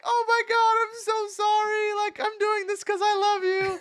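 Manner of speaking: very high, tearful mock voice of his mother; imitating crying mom. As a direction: ham up the sobbing mom apologizing. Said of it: "my mom's crying".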